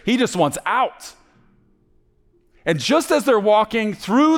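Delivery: rising to high, loud, urgent narration; impassioned story preaching. A narrator's reading. Preacher tells of the boy leaving prison, impassioned and urgent. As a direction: drive the story forward with a preacher's intensity.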